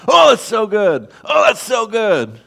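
high, excited squeal of delight; like a kid in a toy store, thrilled; childlike glee. Retold or imitated. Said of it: "God's like a kid in Toys R Us".

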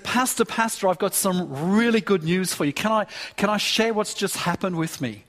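usual speaking voice

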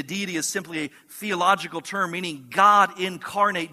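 ordinary talk, nothing marked

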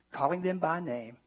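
ordinary delivery

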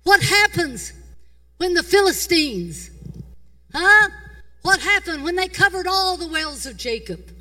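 slow, high, loud preacher's cry; preaching at full force. Acted. Preacher asking the crowd about the wells, slow loud cry. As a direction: draw out each question like a call.

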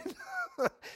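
high laugh. Real, not acted. Laughing at his own story.

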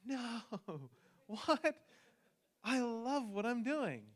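high, loud, laughing mock protest. Pastor recalling his protest to God, high and incredulous. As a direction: play your startled younger self protesting. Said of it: "And I was going".

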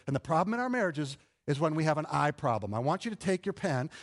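usual speaking voice

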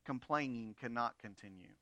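low, quiet, stern parental warning; a hint of a strict father laying down the law; firm parent. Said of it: "Just like a good parent says".

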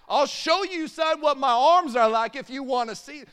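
loud, high-pitched defensive boast; puffed-up, over-loud comeback; indignant dad. Acted. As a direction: blurt it out as a wounded, mock-furious dad. Defensive dad answering child's challenge.

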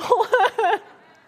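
loud high laugh at her own joke; real laugh. Real, not acted. Speaker laughing at her joke to the congregation. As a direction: burst into a laugh. Joke about three-month sabbatical.

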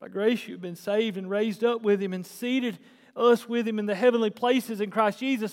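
ordinary delivery

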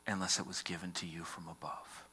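soft, unvoiced, steady answer; Jesus quietly answering the governor, whispering; whispered reply. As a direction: let Jesus' last words drop to a whisper.

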